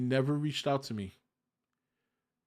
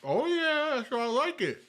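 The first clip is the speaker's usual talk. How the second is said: high, thrilled agreement; delighted; 12 semitones higher than usual. Real, not acted. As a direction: lift the pitch, pure delight. Hearing sandwich is crispy.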